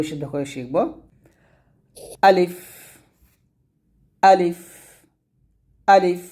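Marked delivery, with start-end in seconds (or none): 2.2-6.2 清晰短促地念 আলিফ; Arabic letter demo. Shown as a demonstration. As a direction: say আলিফ short and crisp, like a Quran teacher. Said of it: "আলিফ, এরকম".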